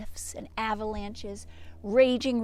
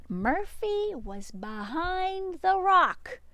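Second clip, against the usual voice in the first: slow, drawn-out twist; slow storytelling reveal; dramatic reveal; 7 semitones higher, slower than usual. Acted. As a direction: savour the surprise slowly.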